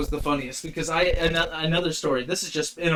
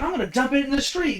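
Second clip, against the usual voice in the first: irate customer; 9 semitones higher, faster than usual. Retold or imitated.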